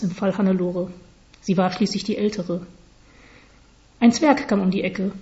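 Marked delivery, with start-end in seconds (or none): none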